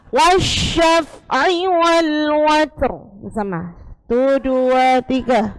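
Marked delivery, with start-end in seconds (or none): 0.1-2.9 very slow "Wasyaf'i wal watr", heavy r; slow recitation. Shown as a demonstration. Teacher reciting a Quran verse to the class, very slow. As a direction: draw out each syllable of "Wasyaf'i". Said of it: "ditambahi ro".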